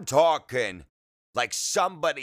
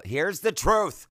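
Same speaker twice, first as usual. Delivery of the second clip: a raised, eager Here's the truth; higher excited Here's the truth; 7 semitones higher than usual. Real, not acted. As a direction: hype up the confession. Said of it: "okay, here we go".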